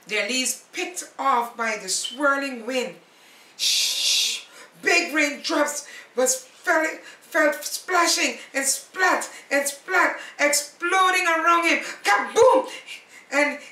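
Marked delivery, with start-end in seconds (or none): 3.6-4.4 loud unvoiced shushing rain; rain hiss. Storyteller makes the rain noise, a loud hiss. 12.1-12.7 high, loud booming kaboom; thunder boom. Acted. Storyteller mimics thunder, a loud booming cry.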